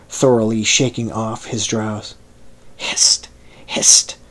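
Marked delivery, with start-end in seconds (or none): 2.8-4.2 urgent hissed whisper; hurried husky whisper. Acted. Stranger hushing Billy in the dark, hurried husky whisper. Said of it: "the hurried whisper now growing husky".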